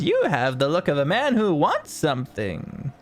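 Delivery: a lightly voiced merchant sizing up a customer; knowing salesman's pitch; jolly tinkerer. Tinkerer to Yester, lightly voiced shrewd merchant. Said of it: "He looks to Yester and goes".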